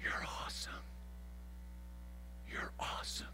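soft, breathy whisper of thanks; God whispering. Acted. God whispering thanks to the confessor, tenderly. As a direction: hush it as if deeply touched. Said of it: "God looks at the confessor of sin and says".

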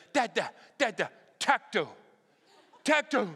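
lightly voiced eager toddler; a hint of an excited two-year-old. Toddler tugging at his dad about a tractor, lightly voiced. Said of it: "he's like".

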